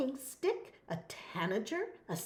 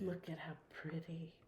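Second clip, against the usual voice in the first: soft whisper; 6 semitones lower, 14 dB quieter than usual. Real, not acted. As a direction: hush it like at bedtime. Joins the hush.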